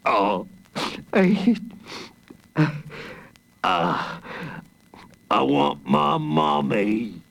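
halting, hammed-up monster blubbering; mock wail, broken by gasps; tearful wailing. A narrator's reading. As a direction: blubber slowly like an overgrown baby. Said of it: "he wailed".

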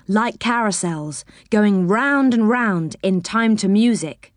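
usual speaking voice

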